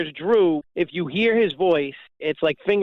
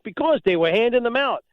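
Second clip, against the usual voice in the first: mimicking Drew; 6 semitones higher than usual. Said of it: "He's like".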